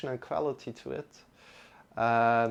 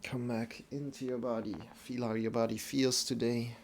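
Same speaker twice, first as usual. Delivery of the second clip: guided meditation. Acted. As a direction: ease them back into the body. Guiding out of meditation.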